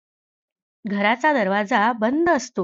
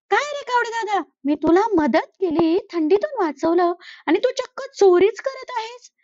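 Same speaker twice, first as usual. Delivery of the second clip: higher sparrow voice, mildly scolding; 7 semitones higher than usual. Acted. Angry sparrow catches the crow eating, mild scolding. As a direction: tell him off for stealing.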